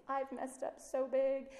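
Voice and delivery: put-on high, slow little-girl plea; mock small child confessing; little girl's voice. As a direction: voice a small daughter owning up. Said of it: "they come to me and they say".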